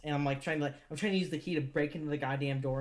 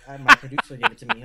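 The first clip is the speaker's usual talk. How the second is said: suddenly louder, fed up with the guard; exasperated retort; 14 dB louder, faster than usual. Retold or imitated. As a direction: push back hard, louder. Retold argument with guard.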